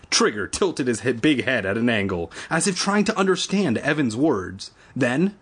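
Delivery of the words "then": plain reading voice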